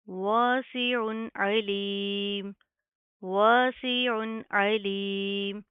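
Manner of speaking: वासिउन अलीम with both ain sounds articulated, very slow; slow, careful recitation; both ains clear. Shown as a demonstration. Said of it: "दोनों आइन की हम proper वाज़िया करेंगे".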